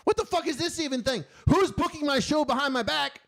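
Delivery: high, incredulous ranting; outraged. Retold or imitated. Host finding out about secret booking, high outraged retelling.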